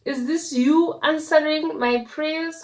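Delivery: loud high shocked appeal. Retold or imitated. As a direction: cry out to God in disbelief. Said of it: "I'm like".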